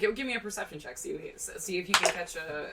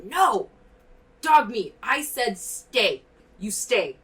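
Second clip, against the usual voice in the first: loud, higher commands to a dog; raised voice, firm barked commands; shouting outside; 3 semitones higher, 9 dB louder, slower than usual. Acted. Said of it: "kinda shouting outside the door".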